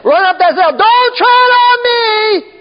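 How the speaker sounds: high, slow warning cry. Retold or imitated. Preacher voicing the flag's warning, high and drawn out.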